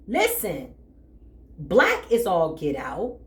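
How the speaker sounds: ordinary delivery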